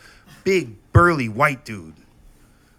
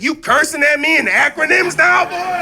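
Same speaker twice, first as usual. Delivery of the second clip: high shouted outrage; mock furious mom; 9 dB louder than usual. Mom yelling at her son for cursing, furious shout. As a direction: yell it like an offended mom.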